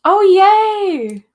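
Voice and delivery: high, loud excited squeal; real burst of high-pitched glee; delighted cheer. Real, not acted. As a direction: cheer happily at good news. Delighted at getting goodbye messages.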